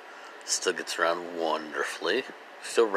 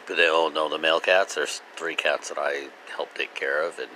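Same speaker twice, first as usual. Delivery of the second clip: ordinary talk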